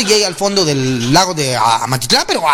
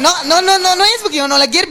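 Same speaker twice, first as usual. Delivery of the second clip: high mock protest; 9 semitones higher than usual. Protesting innocence about mother-in-law.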